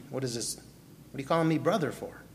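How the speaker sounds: ordinary talk, nothing marked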